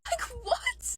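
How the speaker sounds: high-pitched squeal of disbelief; laugh, then squeak it out in disbelief; incredulous. Retold or imitated. Disbelief at being dumped by him.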